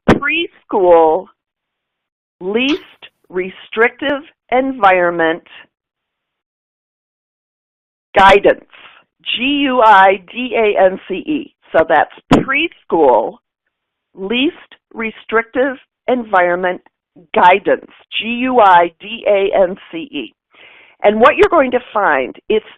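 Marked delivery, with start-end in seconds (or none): 0.1-11.5 slow, spaced out word by word; slow dictation. Real, not acted. Presenter giving parents a search term to Google, said slowly. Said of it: "I'll try and say it slowly". 12.3-20.3 measured repeat for note-takers, with pauses; slow dictation. Real, not acted. Presenter repeating what to Google for parents, slowly. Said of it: "I'll try and say it slowly".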